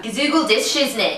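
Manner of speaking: a hint of mock gangster slang; lightly put-on street voice, tongue in cheek; gangster talk. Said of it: "in gangster".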